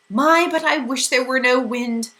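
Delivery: plaintive. A narrator's reading. Mrs Whatsit complaining about the wind, plaintive. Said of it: "Missus Watsit said plaintively".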